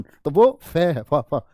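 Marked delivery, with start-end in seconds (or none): none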